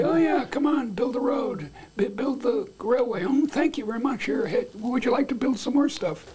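high, gushing and overeager; mock gushing welcome. Imagined Kenyan president gushing to Chinese investors, high and eager. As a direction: fawn over the builders in a high sing-song. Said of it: "I'd say".

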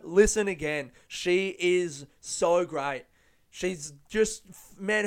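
ordinary talk

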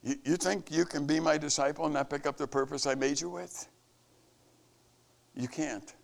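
normal talking voice

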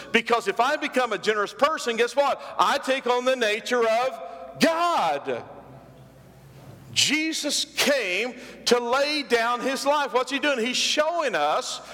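high, ringing preacher build; climbing pulpit fervour, ending in a shout; preaching at full force. Acted.

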